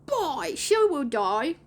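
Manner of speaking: put-on high, drawn-out boy voice, rising on DIE; put-on lost-boy admission, stretched out; Slightly. A narrator's reading. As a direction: admit it slowly with an upward swing. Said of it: "Slightly admitted".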